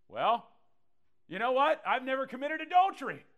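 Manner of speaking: high, loud, smug mock voice; self-righteous protester. Pastor voicing a smug listener answering Jesus, high and loud. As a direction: boast that you've never strayed.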